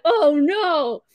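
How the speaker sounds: loud, high cry of dismay; mock dismay. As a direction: exclaim it like a comic disaster. Acting dismay at the bill.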